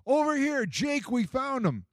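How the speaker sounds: put-on very high boy's voice, slowing on the found news; a kid shouting to his friend in the dark; boy calling out.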